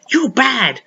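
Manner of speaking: telling a child off, lightly acted; mock scolding. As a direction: voice a reproachful grown-up. Acts out people scolding Emma.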